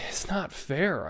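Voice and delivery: a choked, tearful protest. Acted. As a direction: voice catching as she protests. Hazel near tears at news.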